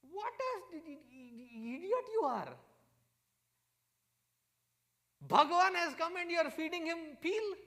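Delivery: high, loud mock scolding, first line drawn out; scolding wife. Wife scolding husband for feeding Bhagwan peel, high hammed-up scolding. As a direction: act out an exasperated wife telling off her husband. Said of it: "Vidura's wife scolded him".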